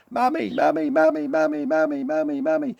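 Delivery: very high, loud chick cries; baby chicks crying for their mother; baby birds calling mummy. A narrator's reading. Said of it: "the babies were going".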